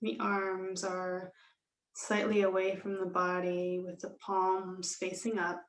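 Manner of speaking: slow, measured yoga nidra guidance with long pauses; guided meditation. Real, not acted. Yoga teacher settling students into Shavasana, slow calm meditation voice. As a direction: ease them into stillness, unhurried and calm.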